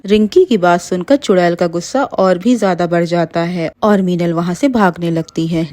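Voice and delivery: ordinary delivery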